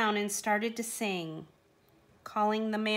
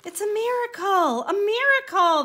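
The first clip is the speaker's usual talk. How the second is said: high, loud, amazed shout; crying out in joy; 11 semitones higher, 8 dB louder than usual. A narrator's reading. Donkey crying out his joy at being healed. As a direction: shout the wonder of it. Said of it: "the donkey cried out".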